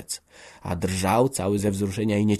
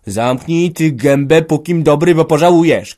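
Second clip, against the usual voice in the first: threatening shout; 7 semitones higher, 11 dB louder than usual. A narrator's reading. Father rushes at son with fists, shouting. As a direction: roar it as a last warning. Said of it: "krzyknął, przyskakując do niego z pięściami".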